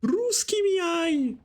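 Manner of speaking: high, slow mock woman's voice; imitating Daniela. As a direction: pitch it way up and stretch it out, teasing a fellow caster. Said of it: "do you want me to do it again".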